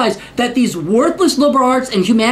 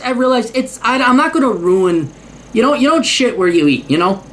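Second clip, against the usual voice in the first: ordinary talk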